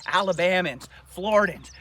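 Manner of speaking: ordinary talk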